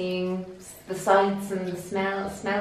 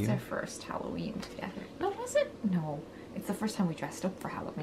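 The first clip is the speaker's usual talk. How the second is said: soft hushed whisper; whispering over a crib; 13 dB quieter than usual. Real, not acted. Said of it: "Being very quiet because the baby's asleep".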